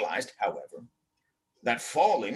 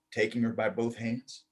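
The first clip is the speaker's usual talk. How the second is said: ordinary delivery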